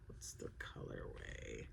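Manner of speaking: low, barely-there whisper while searching; whispered aside. Real, not acted. Crafter checking a yarn label to herself, whispered. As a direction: whisper it to yourself.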